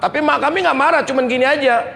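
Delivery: usual speaking voice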